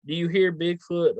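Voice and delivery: ordinary talk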